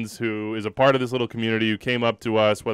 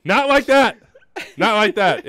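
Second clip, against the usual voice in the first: flustered denial; 10 semitones higher, 6 dB louder than usual. Real, not acted. Correcting innuendo again.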